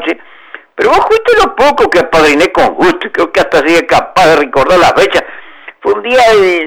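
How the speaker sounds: character voice pitched above the narration; story character. A narrator's reading. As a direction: play the old godfather reminiscing.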